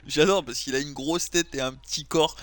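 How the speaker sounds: usual speaking voice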